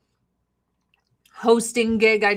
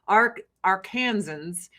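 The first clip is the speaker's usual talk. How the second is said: usual speaking voice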